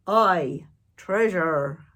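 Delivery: loud crew cheer; rowdy pirate chorus; pirate crew shout. Acted. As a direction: holler it together like pirates. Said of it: "the others shouted".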